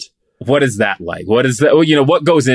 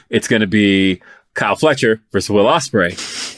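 normal talking voice

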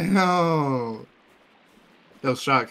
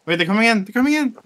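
raised, loud warning shout; alarmed warning; 8 semitones higher, 6 dB louder, faster than usual. Real, not acted. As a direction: warn the team urgently, pitch climbing. Warns teammates of attackers.

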